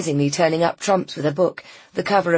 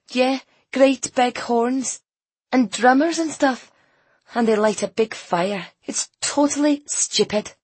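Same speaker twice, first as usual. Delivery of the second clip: put-on high-pitched, scornful teenager; slow, drawn-out teenage disdain; sulky girl; 8 semitones higher, slower than usual. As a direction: list it all with contempt, pitched up. Voices sulky girl Ainsley complaining.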